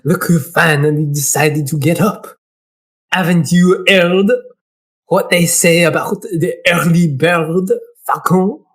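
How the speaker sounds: put-on unhurried French accent; French-accented character voice. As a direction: play a Frenchman greeting a late riser. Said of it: "He's gonna be French".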